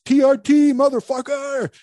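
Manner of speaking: high, loud mock shout out a car window; imitating friends yelling from a car, high and loud. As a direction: yell it at passersby like a rowdy buddy. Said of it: "And he goes".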